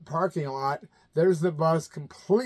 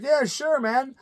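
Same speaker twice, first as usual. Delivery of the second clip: [name] impression; 9 semitones higher, slower than usual. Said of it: "He's like".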